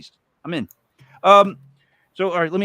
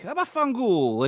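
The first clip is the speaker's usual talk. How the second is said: Italian curse; 7 semitones higher, slower than usual. Retold or imitated. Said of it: "it was really like".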